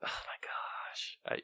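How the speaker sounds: very soft, unvoiced whisper; guilty whisper. Real, not acted. Host feeling bad about ignoring the doorbell, whispering. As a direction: whisper it, keeping hidden from the door. Hiding from trick-or-treaters at door.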